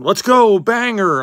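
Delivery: loud, high burst of put-on excitement; mock hype shout. Streamer hyping card pulls to viewers, mock hype shout. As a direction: shout it like a hyped card breaker.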